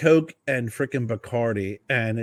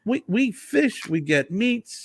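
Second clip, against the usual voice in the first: high dismissive rant; Italian guy scoffing; 11 semitones higher, much slower than usual. Retold or imitated. Winery guy dismissing wine pairing rules, high and scoffing. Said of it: "He goes".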